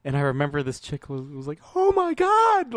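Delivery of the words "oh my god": high, loud horrified outburst; mock shocked cry. Retelling a woman's reaction to the trailer, shocked cry.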